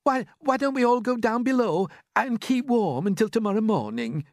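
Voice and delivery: high, loud character voice; Miss Spider. A narrator's reading. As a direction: suggest it eagerly, pitched high. Miss Spider makes a suggestion.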